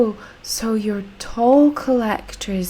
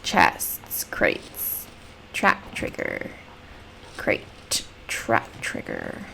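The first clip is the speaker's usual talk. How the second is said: hushed, voiceless reading under the breath; whispering to oneself; 12 semitones lower, 11 dB quieter, much slower than usual. Real, not acted. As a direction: whisper the labels to yourself. Murmurs item names to self.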